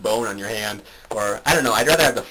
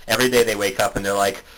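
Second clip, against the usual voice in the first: normal talking voice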